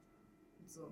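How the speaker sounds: barely voiced, breathy-soft 坐; a bored teen muttering back; limp mumbled reply. Class mumbling back to the teacher, almost unvoiced. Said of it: "all I hear is".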